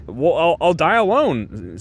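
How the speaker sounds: acted-out hopeless protest; mock despair. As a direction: wail it lightly, imitating a distraught friend. Acted quote of distraught Enid.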